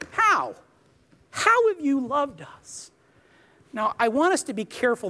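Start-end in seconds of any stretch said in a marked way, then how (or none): 0.1-2.9 a higher, scornful How? then a slow, arrogant question. Retold or imitated. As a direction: throw the question back at God with contempt. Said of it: "this arrogant tone of voice".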